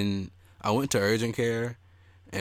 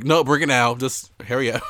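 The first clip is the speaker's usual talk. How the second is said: mock urgent call, louder; urgent order; 5 semitones higher, 9 dB louder than usual. Patient imitating urgent care staff, urgent and loud. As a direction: rush it out like staff in a hurry.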